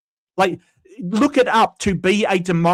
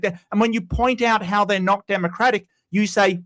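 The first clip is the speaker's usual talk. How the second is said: ordinary talk, nothing marked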